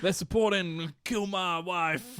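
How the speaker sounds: slow pretend metal-fan voice. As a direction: announce a made-up metal band, hammed up. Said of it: "I don't know metal names".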